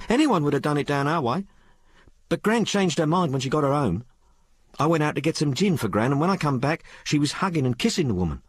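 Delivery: put-on high-pitched, colloquial working girl; working-class maid Sal. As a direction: tell it as an uneducated maid confiding in her mistress. Story figure Sal.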